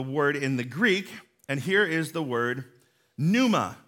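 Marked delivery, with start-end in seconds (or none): 3.2-3.8 "pneuma" said as NOO-muh; silent P. Shown as a demonstration. Pastor teaches the Greek word, "pneuma" with a silent P. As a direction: leave the P off "pneuma", starting on N. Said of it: "the P in this word is silent".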